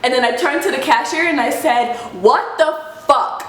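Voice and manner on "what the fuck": emphatic, drawn-out indignation; slow each word in mild outrage; retold angry outburst. She rounds on the cashier, indignant. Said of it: "I'm already screaming, my voice is really loud".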